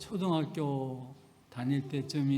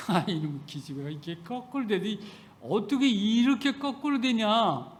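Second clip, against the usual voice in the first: ordinary talk, nothing marked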